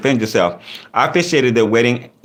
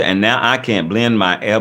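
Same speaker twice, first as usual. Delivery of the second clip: usual speaking voice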